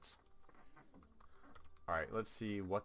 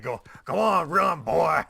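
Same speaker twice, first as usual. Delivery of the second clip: loud, higher hammed-up Southern drawl; southern accent; 8 semitones higher, 16 dB louder, slower than usual. Retelling the enemy's local chat taunt, Southern accent. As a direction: ham up a creepy Southern yokel. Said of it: "I just imagined a deep southern accent with that".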